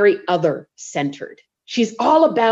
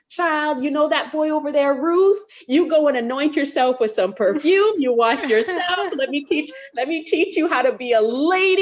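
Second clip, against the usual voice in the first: lightly voiced mothering Naomi; 6 semitones higher than usual. Motherly Naomi voiced teaching Ruth.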